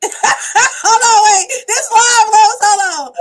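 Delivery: laughing, loud and high; can't stop laughing while talking. Real, not acted. Caught off guard, laughing live.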